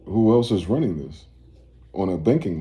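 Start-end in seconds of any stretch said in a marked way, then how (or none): none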